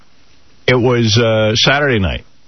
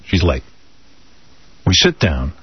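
ordinary talk

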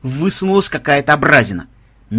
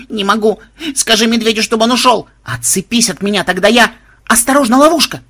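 high, rising panic, louder toward the end; panicked; 8 semitones higher, 5 dB louder than usual. Acted. Granddaughter panicking, clinging to Melissa, loud and high. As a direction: squeal it, growing more frantic.

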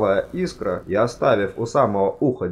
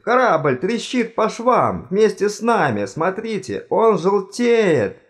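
high, urgent warning swelling at the end; alarmed outcry; 11 semitones higher, 3 dB louder, slower than usual. A narrator's reading. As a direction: cry out as the ship falls apart. Panicking on a sinking ship. (паническое восклицание)

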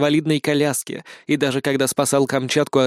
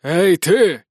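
sharp shout from afar; calling out; 2 semitones higher, 3 dB louder than usual. A narrator's reading. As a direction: call out to grab attention. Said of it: "крикнул вдруг кто-то".

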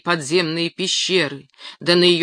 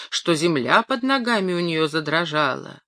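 ordinary delivery, nothing marked

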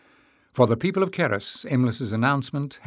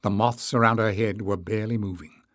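ordinary delivery

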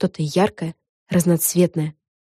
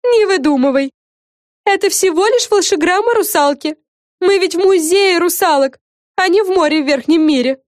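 high, loud storybook fairy voice; high-pitched, animated cartoon fairy; scolding fairy character; 12 semitones higher, 5 dB louder than usual. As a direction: set them straight in a high, emphatic voice.

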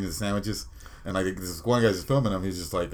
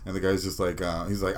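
ordinary talk

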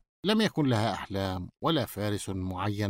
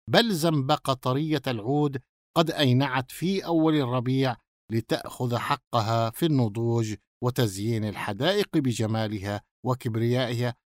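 ordinary delivery

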